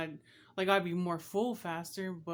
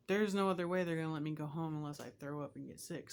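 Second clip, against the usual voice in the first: normal talking voice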